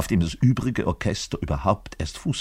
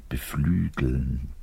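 slow, soft lovestruck whisper; whispered in quiet rapture; dreamy whisper; 7 semitones lower, 6 dB quieter, slower than usual. Acted. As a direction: sigh it out, smitten. Swooning over young soprano.

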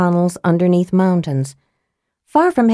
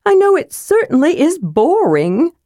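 high whiny kender; bored young kender, pitched up; 10 semitones higher than usual. A narrator's reading. Tass the kender voiced.

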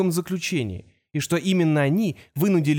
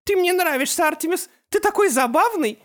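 very high, ringing mocking glee; mock him with giddy delight; gleeful Opal; 14 semitones higher, 3 dB louder than usual. A narrator's reading. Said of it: "Апал звонко рассмеялась".

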